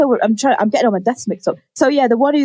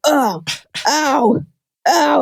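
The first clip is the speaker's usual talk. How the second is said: rising little moans of pain; mock pained moans; 4 semitones higher, much slower than usual. Lewis coming home injured, mock whimpering. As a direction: whimper like you've hurt yourself.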